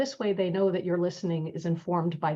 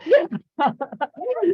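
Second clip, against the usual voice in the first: a burst of high, loud, giddy laughter; high excited laugh; 11 semitones higher, 9 dB louder than usual. Real, not acted. Teacher laughing at her own excitement, with a high, loud laugh. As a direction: laugh out loud, delighted with yourself. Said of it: "I'm so excited".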